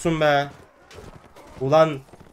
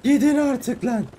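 high, slow, strained whine; despairing wail; 8 semitones higher than usual. Real, not acted. Sick gamer begging enemies to go away, high strained whine. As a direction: plead with the screen, worn out.

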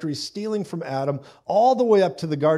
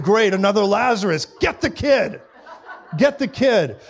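laughing mock panic; 6 semitones higher, 5 dB louder than usual. Soldiers chasing the kid.

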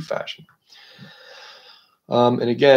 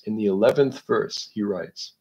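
ordinary talk, nothing marked

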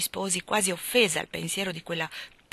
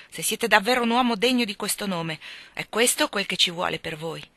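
plain reading voice

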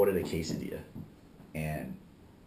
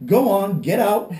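loud, high shout to shoo someone off; reenacted shooing; 10 semitones higher, 9 dB louder, slower than usual. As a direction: act out chasing a customer out the door. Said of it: "I kinda shooed him".

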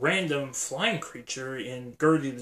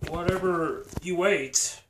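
ordinary delivery

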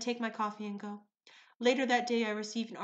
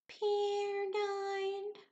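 much higher, mock-somber barista voice; imitating somber barista; 10 semitones higher than usual. Barista announces her goldfish died, mock-somber and high. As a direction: play the grieving barista like a ghost. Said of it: "in the most somber voice ever".

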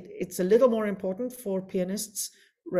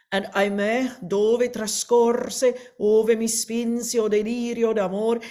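slow, declaimed Italian recitative line; recited Italian verse; 2 semitones higher, 4 dB louder than usual. Shown as a demonstration. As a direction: read the verse out with weight. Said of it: "an example of another versi sciolti".

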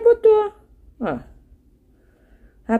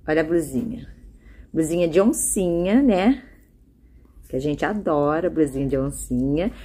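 normal talking voice

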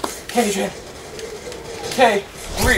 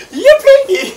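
very high, squealed cheer; over-the-top fake delight; mock glee; 4 dB louder than usual. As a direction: cheer in a silly put-on squeal. Mock joy at dying for package.

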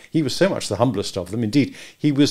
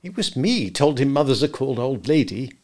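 usual speaking voice

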